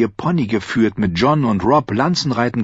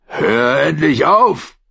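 slow, louder angry command; 6 semitones higher, 4 dB louder, slower than usual. A narrator's reading. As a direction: growl the order out slowly. Zit shaking Arya.

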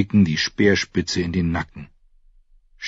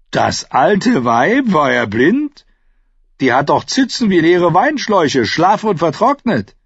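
high, loud, incredulous mockery; crude, loud scorn from a lord; scoffing Theon; 9 semitones higher, 5 dB louder than usual. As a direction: jeer in high-pitched disbelief.

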